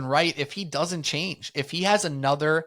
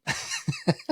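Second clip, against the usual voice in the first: high squeaky laugh; 3 dB quieter than usual. Real, not acted. Laugh at own joke.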